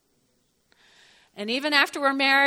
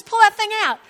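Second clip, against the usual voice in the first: loud order; 9 semitones higher, 10 dB louder, faster than usual. Retold or imitated. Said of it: "he says".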